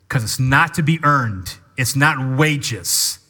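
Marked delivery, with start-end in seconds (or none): none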